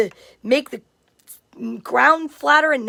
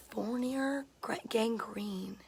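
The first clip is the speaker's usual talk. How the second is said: whispered; 3 semitones lower, 16 dB quieter, slower than usual. Real, not acted. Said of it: "I don't know what that is".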